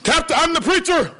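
high-pitched mock pulling of rank; mock self-importance. Preacher jokingly pulling rank like the captain, indignant.

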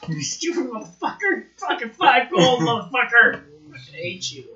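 loud laughing cussing rant; swearing tirade. Acted. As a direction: cuss him out, half laughing. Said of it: "I start cussing him out".

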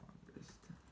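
breathy whispered echo of "Understand"; hushed repetition of "Understand". Shown as a demonstration. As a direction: whisper "Understand" back softly. Whispered echo of model word.

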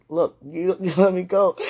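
loud, laughing playful scold; loud laughing mock scold. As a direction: tease him to let go. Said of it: "I was like".

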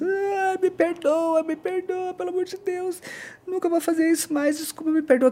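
high, whimpering mock sobs; crying like a child. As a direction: imitate a tearful, begging apology in a high thin whine. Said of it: "começou a chorar que nem criança". (imitando choro de criança, agudo)

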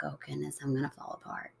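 very soft, low murmur to herself; barely audible dread of falling apart; hushed inner thought. Retold or imitated. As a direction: say it under your breath, near breaking. Inner thought when kids get sad.

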